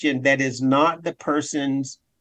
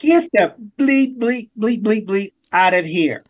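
high angry outburst, bleeped curses; swearing tirade; 5 semitones higher than usual. Retold or imitated. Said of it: "Excuse my language".

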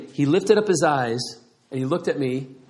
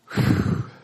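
long, voiceless puff of breath; unimpressed exhale. Retold or imitated. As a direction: imitate a smoker's indifferent exhale. Unimpressed bar patron imitated.

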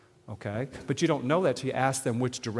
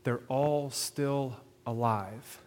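very slow, each word set apart; deliberate, drawn-out punchline delivery; emphatic slow reveal; much slower than usual. Real, not acted. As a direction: stretch it out so every word lands. Preacher lands his key point.

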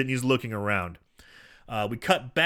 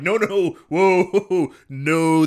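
loud, raised-pitch waving-off; mock refusal; 4 semitones higher, 7 dB louder, much slower than usual. Fans shoot down the comedian, loud mock refusal. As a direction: push the offer away with loud exaggerated noes.

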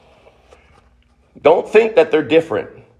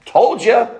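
higher, scoffing mock voice; Jesus not saying this, imagined as a smug I-told-you-so; 7 semitones higher than usual. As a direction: sneer it like a smug know-it-all. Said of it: "He didn't go".

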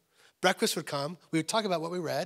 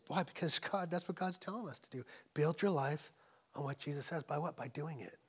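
ordinary talk, nothing marked

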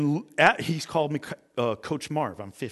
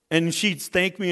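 normal talking voice

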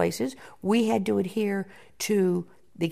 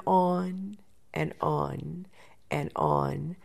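slow, stretched-out repetition; drawn out; 4 semitones lower, 5 dB quieter, much slower than usual. Real, not acted. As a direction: draw out each "on" as if it never ends. Mimics a routine dragging on.

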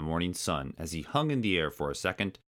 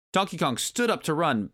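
usual speaking voice

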